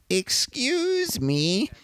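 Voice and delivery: put-on very high, slow, quavering old woman; old lady voice. Old woman in the theater asking the narrator, very high and slow. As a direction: squeak it out like a tiny elderly lady. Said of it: "I'll just use that voice because it's funny".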